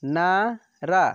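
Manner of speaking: very slow syllables. Shown as a demonstration. Teacher sounding out syllables for pupils, very slow. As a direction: spell it out slowly, syllable by syllable. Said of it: "direct आपको बोलना है कि".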